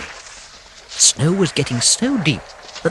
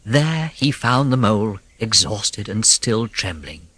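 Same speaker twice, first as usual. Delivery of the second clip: ordinary delivery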